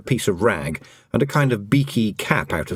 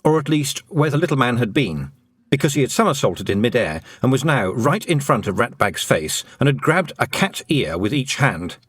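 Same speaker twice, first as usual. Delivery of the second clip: plain reading voice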